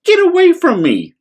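high, loud dismissive order; haughty snap. Acted. Rainbow Fish sending the little fish away, high and loud. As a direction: shoo him away proudly. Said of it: "cried the Rainbow Fish".

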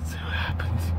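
low breathy whisper. Real, not acted. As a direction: whisper so no one hears. Hiding under a truck.

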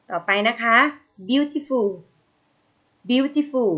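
ordinary talk, nothing marked

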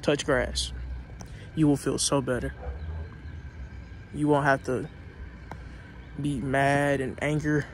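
loud, fired-up motivational speech; loud preaching pep talk. Real, not acted. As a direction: pump them up like a coach. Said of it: "Pep Talk, Motivation Talk".

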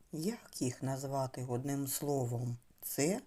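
ordinary talk

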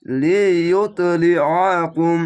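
লিউত then লি, আ, কুম split slowly and loud; slow clear drill of লি আ কুম; clear syllable drill. Shown as a demonstration. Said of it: "এখানে অবশ্যই স্পষ্টভাবে পড়েন".

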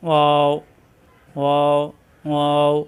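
ওয়াও said from both lips with the lips kept open, never closing; lips apart. Shown as a demonstration. Teacher models ওয়াও for students, lips kept open. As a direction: round ওয়াও through open lips, no lip contact. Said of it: "মিলবে না, ঠোঁট ফাঁকা থাকবে".

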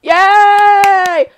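high, loud yay; cheer. Real, not acted. Streamer cheering the sing-off result, high and loud. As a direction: cheer for the winner.

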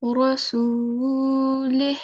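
rasulih with long "suu", ending on an airy "h"; slow recited rasulih, cut at "lih"; stopped with sukoon. Shown as a demonstration. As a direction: drop the final "i" and breathe out the "h". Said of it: "it will change as".

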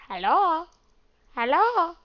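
high, rising cartoon call; Luigi. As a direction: call out hello like a game character. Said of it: "I feel like Luigi in Luigi's Mansion".

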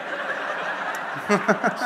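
loud, rising burst of laughter; real laughter breaking out. Real, not acted. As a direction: laugh at the absurd story. Laughs at his own story.

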